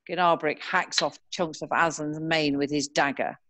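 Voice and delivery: usual speaking voice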